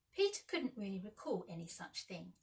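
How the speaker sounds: plain reading voice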